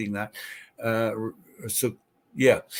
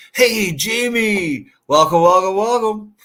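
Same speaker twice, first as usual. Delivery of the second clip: excited greeting; 7 semitones higher, 6 dB louder than usual. Real, not acted. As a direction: call out a big welcome. Greeting a viewer arriving in chat.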